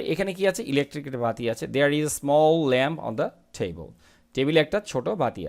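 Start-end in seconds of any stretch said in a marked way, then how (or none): 1.7-3.9 each word read out slowly and clearly; slow model reading. Shown as a demonstration. Teacher reading the sentence slowly to students. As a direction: read it slowly for the class to follow. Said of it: "teacher reads model sentence slowly".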